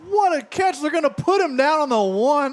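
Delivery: high excited outburst. Real, not acted. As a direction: cheer the catch. Reacting to the big catch.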